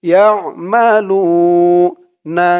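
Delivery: slow melodic Quranic recitation. Shown as a demonstration. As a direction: chant the verse with tajweed. Drilling and reciting the verse. (ধীর সুরেলা কুরআন তিলাওয়াত)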